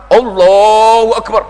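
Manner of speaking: drawn-out, loud Allahu Akbar meant to be heard; loud showy prayer call. Retold or imitated. Preacher mimics show-off praying loudly for neighbours. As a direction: belt it so the neighbours hear.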